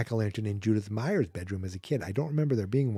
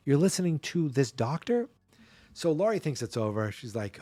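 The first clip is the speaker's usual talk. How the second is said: ordinary talk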